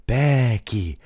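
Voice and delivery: lightly voiced mocking chant; mocking singsong. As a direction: taunt the name like Charlotte mocking her. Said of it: "she's mocking everybody".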